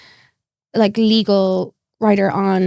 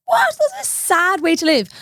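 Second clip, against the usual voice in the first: incredulous retort; 9 semitones higher than usual. Retold or imitated. Retelling her shocked reply to John.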